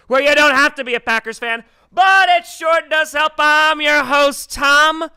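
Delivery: loud, punchy podcast host opening; show host intro. Acted. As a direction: announce it like a big show start.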